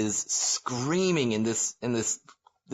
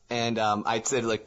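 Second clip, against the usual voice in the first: normal talking voice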